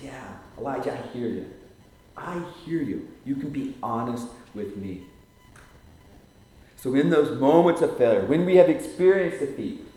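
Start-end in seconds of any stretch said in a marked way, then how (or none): 0.0-5.1 softer, tender and calm; gentle reassurance. Acted. Preacher voicing God's gentle answer to Elijah. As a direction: speak as a kind listener.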